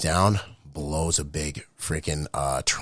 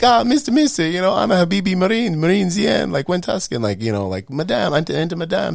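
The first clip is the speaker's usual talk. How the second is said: high, loud mock broken English; mock Iraqi soldiers; 7 dB louder than usual. Mimicking Iraqi army soldiers greeting Marines, high and loud. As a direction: ham up the eager soldiers calling out. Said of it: "they're like".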